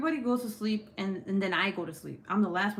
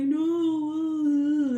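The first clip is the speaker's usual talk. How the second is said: loud, high mock wail; imitated crying; 8 semitones higher, 8 dB louder than usual. Mom imitates her crying son, mock wail. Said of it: "I hear my son crying".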